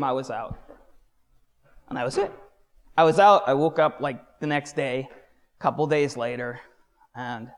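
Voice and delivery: ordinary talk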